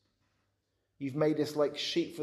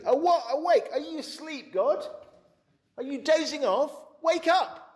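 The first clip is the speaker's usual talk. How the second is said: much higher, loud urgent shout; desperate cry to wake God; 13 semitones higher, 8 dB louder than usual. Acted. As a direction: yell at a sleeping God to wake. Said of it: "Verse twenty-three".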